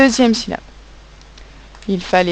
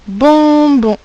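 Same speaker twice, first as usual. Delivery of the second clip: bonbon called out higher and louder; dictation call; 7 semitones higher, 7 dB louder than usual. Shown as a demonstration. Teacher calling out bonbon to pupils, loud. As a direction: announce bonbon loudly to the class. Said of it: "dictating the word".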